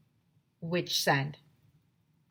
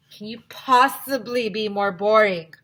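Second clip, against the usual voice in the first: bored jab; 3 semitones higher, 9 dB louder, slower than usual. Acted. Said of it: "asked Alexia".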